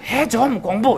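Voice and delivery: 순서를 바꿔 외치는 엄마; mock parent yell, fronted verb. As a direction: bark the verb first like a nagging parent. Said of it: "그 다음에 앞으로 보내요".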